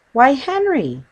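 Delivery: high, loud surprised exclamation; surprised exclamation to her husband; exclaiming. Acted. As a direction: catch your breath in surprise. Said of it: "she exclaimed".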